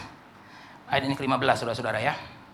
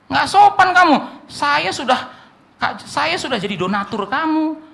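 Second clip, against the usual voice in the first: indignant patron; 8 semitones higher, slower than usual. Acted. Patron voiced, still indignant.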